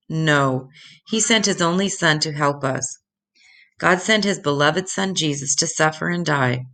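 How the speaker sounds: usual speaking voice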